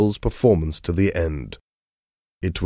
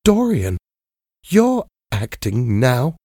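trembling; 6 semitones higher than usual. A narrator's reading. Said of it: "she said, trembling".

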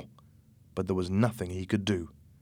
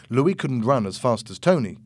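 ordinary delivery

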